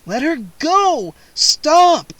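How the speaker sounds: loud high desperate plea; pleading. A narrator's reading. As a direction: beg and yell him off her. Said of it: "I pleaded".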